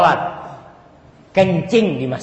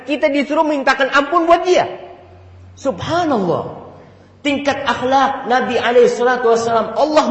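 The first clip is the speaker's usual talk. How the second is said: rising preaching fervor; 9 semitones higher, 4 dB louder than usual. Acted.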